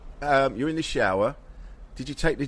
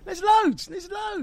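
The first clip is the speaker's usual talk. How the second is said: high shout; much slower than usual. Real, not acted. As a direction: shout it out in protest. Protesting loudly.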